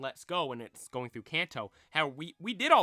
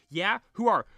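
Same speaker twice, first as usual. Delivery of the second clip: startled; 6 semitones higher, 8 dB louder than usual. Acted. Red startled by old man.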